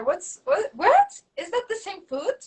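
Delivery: a doctor who cannot believe his eyes; astonished doctor. Retold or imitated. Doctor seeing the healed foot, astonished. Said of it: "he's like".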